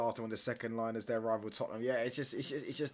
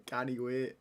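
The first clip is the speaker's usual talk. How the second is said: lightly mimicked Scottish-style accent; Scottish-sounding accent; slower than usual. Streamer echoing the line between laughs, mock Scottish accent. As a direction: repeat it in a mock Scottish lilt. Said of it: "you fucking Scottish".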